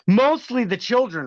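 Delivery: MOSTLY pitched high and punched; pointed sarcasm. Real, not acted. Streamer needles Jordan Geiger about children, pointed sarcastic repeat.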